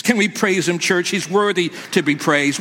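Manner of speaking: usual speaking voice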